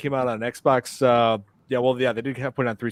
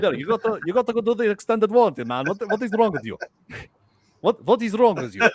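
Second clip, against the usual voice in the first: high, exasperated fake Russian accent; mock Russian accent; 7 semitones higher than usual. Prank caller berating customer over warranty, mock Russian accent. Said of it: "you're impressed by that accent".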